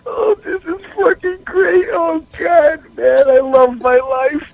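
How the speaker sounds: high pitched, tearful laughing words; blissed-out giggling through tears; real laughter tears; crying with laughter. Real, not acted. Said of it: "here in tears with so much fucking laughter".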